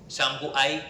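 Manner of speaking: ordinary delivery, nothing marked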